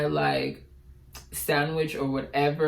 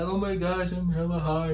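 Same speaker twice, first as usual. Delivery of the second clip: mock stoned voice; 2 semitones higher than usual. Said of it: "I was just faking it".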